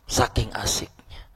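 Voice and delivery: hushed whispered repeat. Shown as a demonstration. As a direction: breathe it as a secret echo. Repeats phrase, second time whispered.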